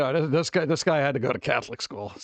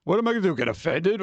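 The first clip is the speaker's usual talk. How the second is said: ordinary delivery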